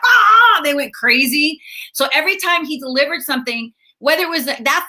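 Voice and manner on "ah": high loud mock crowd cheer; crowd roaring. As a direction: roar like a thrilled crowd. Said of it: "And they're like".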